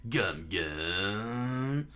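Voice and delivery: plain reading voice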